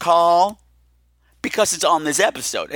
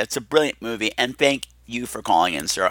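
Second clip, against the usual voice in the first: ordinary talk, nothing marked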